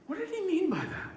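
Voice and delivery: high-pitched troubled wondering; puzzled. Acted. Believer questioned about Muhammad, wondering and troubled. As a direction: act out someone perplexed, pitch climbing to the last question. Voices a person left puzzled.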